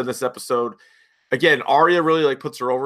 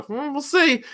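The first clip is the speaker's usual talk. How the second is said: knowing tease; 14 semitones higher, slower than usual. Retold or imitated. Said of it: "I go".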